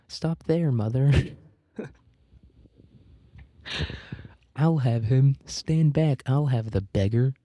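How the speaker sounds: a hint of boyish bravado; lightly acted boy. A narrator's reading. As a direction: warn mother off like an eager boy. Eldest boy warns mother in story.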